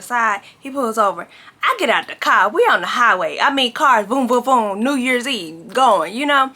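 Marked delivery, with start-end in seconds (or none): none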